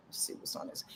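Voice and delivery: breathy whisper to herself; whispered aside. Real, not acted. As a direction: mutter it under your breath as you look. Checking chart to herself.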